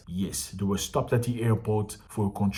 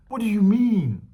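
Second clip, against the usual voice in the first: incredulous; 10 semitones higher, 4 dB louder than usual. Real, not acted. Outraged repeated question.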